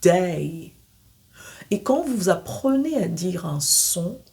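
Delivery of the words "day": "Day" gliding from E to I; "Day" as E then I; diphthong day. Shown as a demonstration. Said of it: "son E accent aigu et I".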